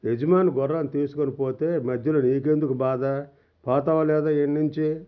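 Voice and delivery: plain reading voice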